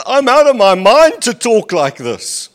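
loud, exasperated line of scripture; emphatic reading. Acted. Preacher reading Paul's outburst to the church, loud and exasperated. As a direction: throw it out like a man fed up with himself. Reads Paul's self-mockery.

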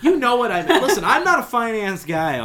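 loud and high, laughing; mock defensive outburst. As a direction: burst out in playful defense.

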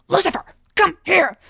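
high-pitched mock outburst; sudden acted yell at a stubborn cat; imitated yell. Cinderella losing it at Lucifer the cat, acted high yell. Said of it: "like, yells at him".

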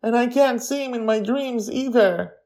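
high tearful lament; a boy crying over lost dreams. A narrator's reading. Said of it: "Charlie cries again".

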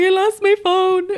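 high, slow teary wail; slow, high, crying complaint; tearful whimper. Retold or imitated. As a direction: whimper it like you're about to cry. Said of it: "And I was like".

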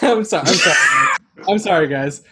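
laughing apology; breaking up with laughter. Real, not acted. Cracking up at a joke.

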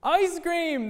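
put-on high, excited kids' shout of ice cream; children shouting. A narrator's reading. Children spotting the ice cream truck, high excited shout. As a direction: call it out like thrilled children. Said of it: "the children shouted".

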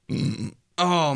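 burp while talking. Real, not acted. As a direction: burp through the word. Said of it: "I had to burp".

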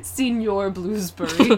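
slow, drawn-out fake Russian; badly faked Russian accent; mock Russian accent. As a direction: introduce a mysterious figure in bad Russian. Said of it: "You sound Russian".

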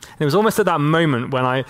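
normal talking voice

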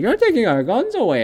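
high, whiny mock voice of gun owners; mocking Republicans. Mocking Republicans crying about their guns, high whiny voice. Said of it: "Republicans are gonna be like".

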